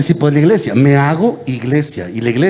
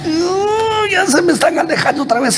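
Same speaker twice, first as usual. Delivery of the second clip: mock dismay. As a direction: wail "uh" high, then rush on in mock alarm. Mock dismay at unresponsive audience.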